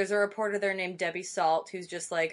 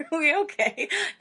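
laughing; 12 semitones higher, 5 dB louder than usual. Real, not acted. Laughing after a slip.

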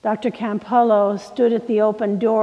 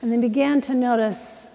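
normal talking voice

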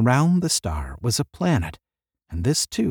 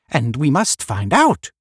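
raised, louder voice; crying out; 4 semitones higher, 5 dB louder than usual. A narrator's reading. Another of the group demanding answers, crying out. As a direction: call it out insistently. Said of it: "cried another".

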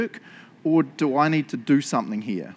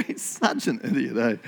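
normal talking voice

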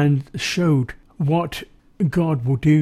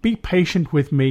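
ordinary talk

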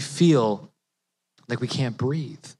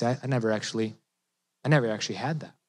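quiet admission; 4 semitones lower, 8 dB quieter, much faster than usual. Retold or imitated. Person admitting no real faith.

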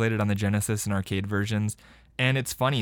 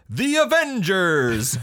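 triumphant drawn-out yell, high and loud; hammed-up victory cry; Captain America; 8 semitones higher, 5 dB louder, much slower than usual. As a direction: belt out each word slowly. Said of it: "then he yells".

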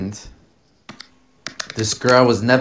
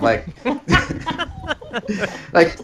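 usual speaking voice

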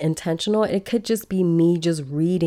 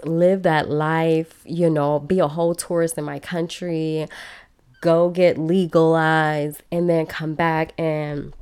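ordinary talk, nothing marked

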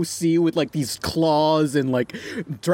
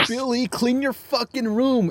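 much higher, slow nagging mom; mock yelling mom; 9 semitones higher than usual. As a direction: nag like a cartoon mother. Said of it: "his mom comes in and is like".

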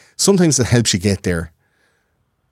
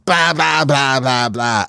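put-on drunk babble; 5 semitones higher, slower than usual. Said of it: "And I'm going".

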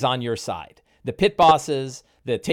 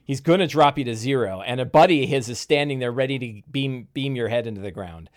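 ordinary talk, nothing marked

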